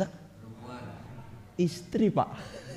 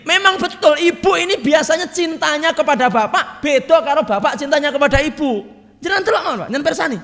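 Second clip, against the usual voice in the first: excited laughing tease; 11 semitones higher, 7 dB louder than usual. Real, not acted. Laughing teasing of the congregation.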